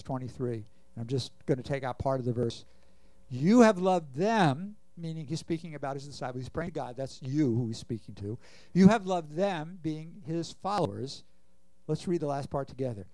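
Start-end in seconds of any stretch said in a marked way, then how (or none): 3.3-4.7 slow and louder, each word of "You have loved them" leaned on; stressed verse. Shown as a demonstration. Preacher pressing the verse on the congregation, slow emphasis. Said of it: "preacher slowly stresses the verse". 8.7-9.8 slow and louder, each word of "You have loved them" leaned on; stressed verse. Shown as a demonstration. Preacher pressing the verse on the congregation, slow emphasis. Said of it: "repeats the verse with emphasis".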